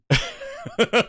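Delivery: short high chuckle; amused giggle. Real, not acted. Streamer amused by his translator's result, giggling. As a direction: giggle at how well it worked.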